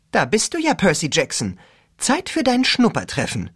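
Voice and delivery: lightly voiced gushing cheerleader; bubbly cheerleader. A narrator's reading. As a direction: gush it brightly into the mic.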